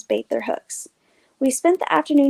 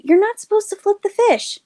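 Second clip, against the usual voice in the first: higher scolding voice; chiding; 9 semitones higher, 4 dB louder than usual. A narrator's reading. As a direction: scold the little brother. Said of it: "I chided".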